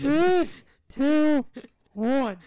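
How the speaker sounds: loud high countdown; shouted countdown. Acted. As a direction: call out the count loudly. Loud countdown to pop cans.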